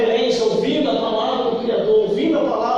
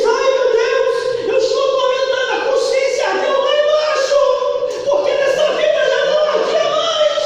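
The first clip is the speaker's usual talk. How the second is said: tormented rich man; 12 semitones higher, 5 dB louder than usual. Acted. Voicing tormented rich man in hell.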